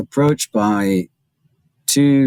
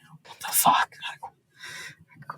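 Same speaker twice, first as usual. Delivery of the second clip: mock whisper; 10 dB quieter, much slower than usual. Agents muttering in Arabic.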